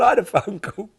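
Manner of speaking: put-on high excited manager accent breaking into a laugh; manager's accent. Manager announcing the phone call, high accent with laughter. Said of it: "I can't do the accent".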